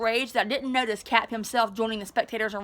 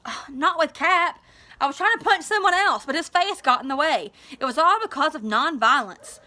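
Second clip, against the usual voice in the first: flustered excuse; 5 semitones higher, 3 dB louder than usual. Acted. Daryl sheepishly explaining to nurse.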